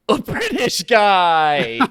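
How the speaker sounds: high, loud and drawn out; excited announcement. Real, not acted. Host revealing the wheel's pick, excited announcement.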